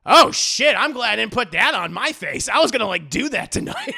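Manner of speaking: high, loud startled outburst; shocked relief. Retold or imitated. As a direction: blurt it out, stunned he nearly wore it. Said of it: "Dio's kinda watching him going".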